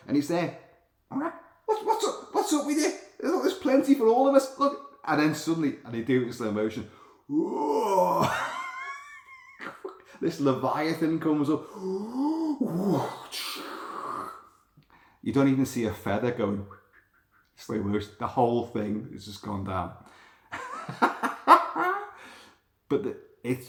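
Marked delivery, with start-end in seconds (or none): 1.1-5.0 much higher, chirpy seagull voice; cheery seagull. Pretend. Voicing the oblivious seagull. 7.3-9.3 shrieking laugh, much higher; high squealing laugh. Real, not acted. Laughing at seagull being eaten. 11.7-14.4 long, high mock gasp; dramatic gasp. Shark surges up. 20.5-22.3 high, rising burst of laughter; high cackle. Real, not acted. Laughing at the gull's fate.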